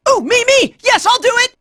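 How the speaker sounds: high, eager exclamation; eager volunteering. Acted. Fighter begs to be the one, high and eager. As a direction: jump in to be picked.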